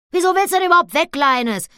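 very high, loud and fast; called out. Acted. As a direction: rush it out, baffled. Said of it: "rief Annabella". (sehr hoch, laut und schnell gerufen)

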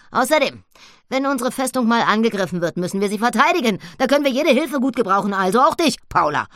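high, loud and fast pig voice; a piglet eager for battle. Acted. Annabella about defending the fort. (Annabella, hoch, laut und schnell)

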